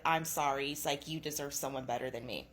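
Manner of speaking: low, subdued apology, retold; remorseful. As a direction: imitate a sorry husband, dropping pitch and volume. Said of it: "he's remorseful".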